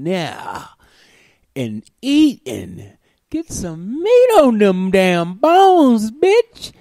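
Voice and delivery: put-on scolding the skinny girl to eat; mock scolding. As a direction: boss her around like a bossy aunt. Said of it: "I'm like".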